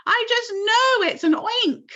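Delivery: very high loud scream; scared scream. A narrator's reading. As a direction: insist in panic. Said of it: "screamed the head of the fire department".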